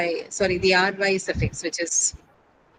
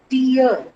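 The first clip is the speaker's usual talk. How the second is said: "tier" higher and louder, falling away; stressed last syllable; 4 semitones higher, 5 dB louder than usual. Shown as a demonstration. Teacher explaining what attracts stress, "tier" high and emphatic. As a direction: punch "tier" as a gliding diphthong. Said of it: "the nature of the last syllable".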